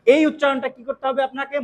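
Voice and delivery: ordinary talk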